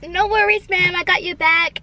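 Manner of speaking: very high, exaggerated drive-through worker accent; put-on accent. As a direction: imitate an obliging server in a high, hammed-up voice. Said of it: "he literally said it in that accent".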